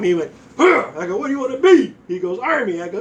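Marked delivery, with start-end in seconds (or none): 0.6-0.9 short, high, loud grunt; a loud "huh" as if struck; yelped grunt on impact; startled grunt. Retold or imitated. Recruit's grunt when hit. 1.2-1.9 loud, high drill-style demand; raised-voice military questioning; barked question. Retold or imitated. Examiner questioning recruit. 2.4-2.8 strained recruit. Retold or imitated. Hit recruit answers.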